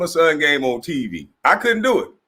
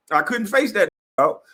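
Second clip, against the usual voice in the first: normal talking voice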